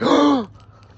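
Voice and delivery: loud, high mock gasp; amazed gasp. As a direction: gasp in exaggerated awe. Tribe reacts to his feat.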